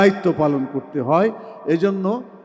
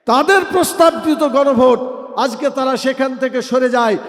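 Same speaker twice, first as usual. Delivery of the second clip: loud, high-pitched political accusation; forceful podium shout; raised oratory; 6 semitones higher, 5 dB louder than usual. Real, not acted. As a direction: raise the voice to call out a broken promise. Rally speech, accusing the ruling party.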